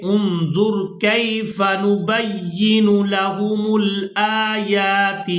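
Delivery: slow melodic tajweed recitation; Quran recitation. Shown as a demonstration. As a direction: intone it slowly. Recitation demo.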